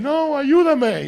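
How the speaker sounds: very high, loud, slow wail; mock crying plea. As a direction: drag out a hammed-up sob for help. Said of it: "And they're crying".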